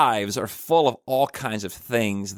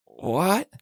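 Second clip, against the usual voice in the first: surprised what; 8 semitones higher than usual. Retold or imitated. Reacting to rabbi's answer.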